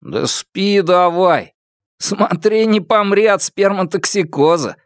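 teasing dorm chorus, voiced by one reader; clamoring roommates. A narrator's reading. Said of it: "загалдели парни".

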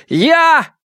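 high, explosive one-word shout; crying out. A narrator's reading. Фан Му trying one last way to save the victim, crying out. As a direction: shout it out with full force. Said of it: "выкрикнул".

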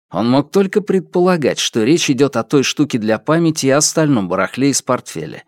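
ordinary delivery, nothing marked

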